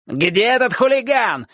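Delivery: high indignant yell; shouting. A narrator's reading. Dog-catcher searching the yard for a boy, shouting. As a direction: yell the demand, pitched high. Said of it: "кричал Собаколов".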